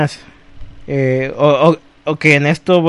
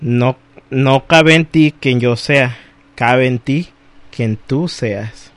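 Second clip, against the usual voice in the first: poem recitation; 4 semitones lower, slower than usual. Acted. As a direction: recite it gently and thoughtfully.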